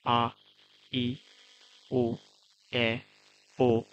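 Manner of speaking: each vowel said slowly and clearly, one at a time with a pause; reciting kana sounds. Shown as a demonstration. Teacher reads the vowel sounds out to students, slow and clear. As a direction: model the vowels a, i, u, e, o for learners. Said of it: "ওইগুলোর just pronunciation".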